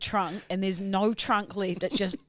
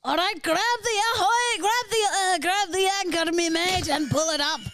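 put-on old Irish sailor; 8 semitones higher than usual. Asked to be an old-timey Irish sailor, hammy Irish sailor voice. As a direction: play a jolly old seaman from southern Ireland. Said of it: "you're an old-timey sailor from the south of Ireland".

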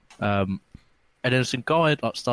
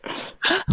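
sharp gasp. Real, not acted. Spots a friend arriving drunk.

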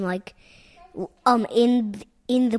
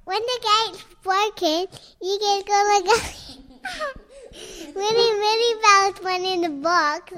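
silly clown; 10 semitones higher, faster than usual. Real, not acted. Child excitedly describing game.

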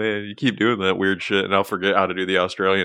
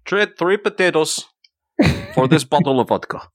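put-on Eastern European accent; 2 semitones higher than usual. Player joining the bit in mock Eastern European accent. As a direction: ham up an Eastern European trader. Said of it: "Terrible Eastern European cliches".

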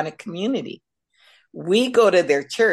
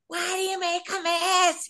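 high, exasperated mother scolding, much higher; mock scolding; 13 semitones higher than usual. Mother yelling at her kids over a mess, mock scolding. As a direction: play the fed-up mom at the kids, pitched up.

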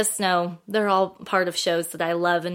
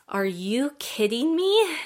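slow, higher-pitched amazement; acting out starstruck astonishment; retold disbelief; 5 semitones higher, slower than usual. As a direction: stretch it out, climbing in disbelief. Retold disbelief at seeing celebrity.

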